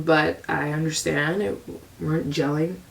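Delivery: usual speaking voice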